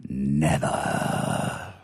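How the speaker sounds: low breathed whisper of refusal; defiant whisper. Acted. Angel refuses his friend's advice, low defiant whisper. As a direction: breathe out a quiet final no.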